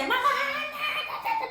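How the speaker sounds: very high, slow drawn-out whiny wail; tantrum wail. Acted. Susana throwing a tantrum at her mother, high wailing child. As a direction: wail like a spoiled kid in a tantrum. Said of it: "empezó a hacer un berrinche".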